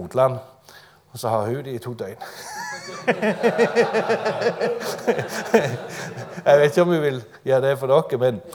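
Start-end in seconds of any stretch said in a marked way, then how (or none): 2.2-6.4 long, high, loud laughing fit; real laughter. Real, not acted. Preacher cracking up at his own weaning joke, loud laughter. 6.4-8.4 loud, amused, breaking into laughter; laughing. Real, not acted. Preacher joking he may not babysit for listeners, laughing.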